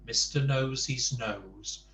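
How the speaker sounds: ordinary delivery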